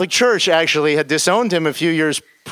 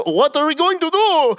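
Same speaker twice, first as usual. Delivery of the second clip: high, panicked question; 13 semitones higher than usual. Retold or imitated. Dragan asking the narrator in panic, high and alarmed. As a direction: ask in rising alarm, far up in pitch. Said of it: "Dragan starts freaking out".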